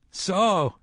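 high, ringing mock exclamation; a gloating sorcerer's cry. A narrator's reading. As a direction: call it out high and taunting. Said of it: "he cried".

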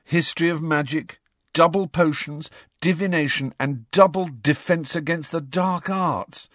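high-pitched list of grievances; groaning complaint. A narrator's reading. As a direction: reel off each item in dismay. Ron groans over the timetable.

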